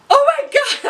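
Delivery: high, loud burst of disbelief; shocked oh my God. Retold or imitated. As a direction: blurt it as it suddenly hits you. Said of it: "when she said that".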